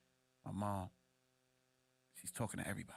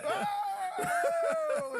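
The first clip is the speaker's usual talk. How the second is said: startled mom; 8 dB louder than usual. Retold or imitated. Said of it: "And she's like".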